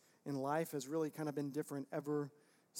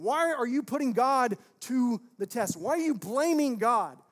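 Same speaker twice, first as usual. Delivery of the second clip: loud, raised-pitch challenge as Moses; acted rebuke; 6 semitones higher, 13 dB louder than usual. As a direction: confront the crowd in mock outrage, full voice. Voices Moses rebuking the people.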